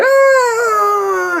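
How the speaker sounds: long, high mock scream; drawn-out high wail of panic. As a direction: imitate someone buried alive screaming. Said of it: "People in there screaming".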